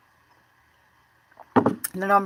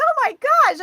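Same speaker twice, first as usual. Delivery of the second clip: high-pitched oh my gosh, a touch louder; retold panic; 4 dB louder than usual. Realizing she overslept, retold high panicked gasp.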